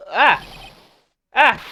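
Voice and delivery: short loud startled cry; startled scream. Acted. Maya spotting her mother's scroll, screaming. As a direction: yelp at the sight of the scroll. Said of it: "Why did you scream like that?".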